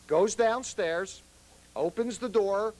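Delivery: ordinary delivery, nothing marked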